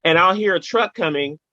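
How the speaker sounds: normal talking voice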